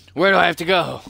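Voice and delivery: frustrated. Real, not acted. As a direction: demand an answer from the game. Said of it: "I'm getting so mad".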